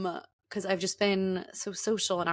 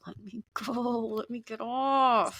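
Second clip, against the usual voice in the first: desperate; 3 semitones higher than usual. Retold or imitated. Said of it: "I'm like".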